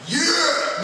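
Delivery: high loud cheer; excited cheer. Retold or imitated. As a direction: whoop it up. Retold cheering.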